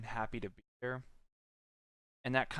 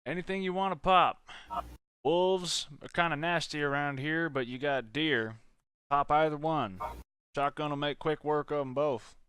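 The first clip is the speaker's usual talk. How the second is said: put-on southern accent; 11 dB louder than usual. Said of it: "you were using a little bit of a southern accent".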